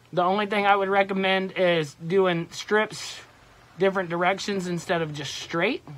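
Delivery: muffled through fabric mesh; through a face mask. Real, not acted. Seen 5: Leafy camo mask covers the whole head throughout; reference frames show the uncovered face.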